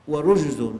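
ورجز with a u after the ر: wa-rujzun; damma on first letter. Shown as a demonstration. Preacher showing listeners the word's three vowellings, ورجز with damma, "wa-rujzun". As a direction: say ورجز loudly as "wa-rujzun", short u. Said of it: "بالكسر والفتح والضم".

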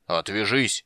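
plain reading voice

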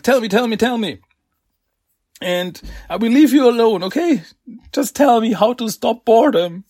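high, pushy pleading; eager begging. Retold or imitated. Villain pestering Donnie for the secret, high eager pleading. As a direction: nag them like an impatient kid. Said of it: "And like".

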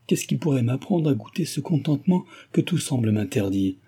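plain reading voice

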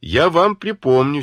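выше и громче, сквозь злость; пригрозить сестре и матери; злая угроза. Acted. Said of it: "зло сказала Дуня". (злобно, повышая голос)

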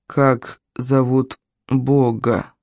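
very slow, low interrogation; low, drawn-out demand; ominous well spirit. Acted. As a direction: ask it as an ancient spirit, word by word. Spirit demands the god's name.

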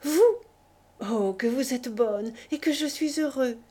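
loud, high joyful cry. A narrator's reading. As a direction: burst out with delight. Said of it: "il eut un cri de joie".

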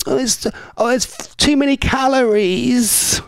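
high, whiny, calorie-counting voice; fussy Kylie. Retold or imitated. Host imitating Kylie fretting over a wrap, high and whiny. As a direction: squeal the worry about calories. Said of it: "She'd be like".

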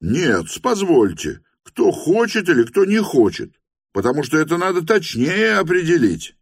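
high, insistent objection; objecting. A narrator's reading. Voices in the room demand precision, high insistent objection.

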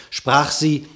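usual speaking voice

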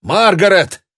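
high, loud call. A narrator's reading. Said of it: "позвал".

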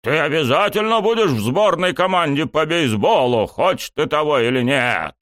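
high-pitched forceful insistence; threatening bark. A narrator's reading. Coach drafting student into team, threatening bark. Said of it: "рявкнул он угрожающе".

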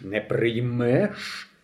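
дуже повільно, тихо й загрозливо; протягни з погрозою; погрозливе питання. A narrator's reading. Бандит погрожує старому. (бандит повільно й загрозливо перепитує)

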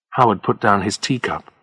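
usual speaking voice

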